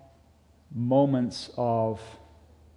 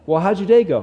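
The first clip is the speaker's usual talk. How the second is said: higher, louder prodding wife; wife asking; 5 semitones higher, 8 dB louder, faster than usual. Acted. Wife pressing her husband about his day, higher and louder. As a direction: coax the husband to open up.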